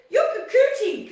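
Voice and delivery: high, loud put-on dad voice with a laugh; dad's teasing voice. Dad teasing daughter about her outfit, high laughing mock voice. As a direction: squeal it like a teasing father. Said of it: "He goes".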